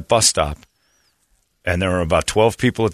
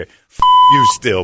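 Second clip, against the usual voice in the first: mock shout; 6 dB louder, slower than usual. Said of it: "the guys behind him".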